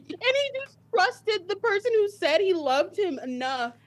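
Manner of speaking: high, loud, tearful protest; upset, near tears. Real, not acted. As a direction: let the hurt break through. Upset over the sacrifice story.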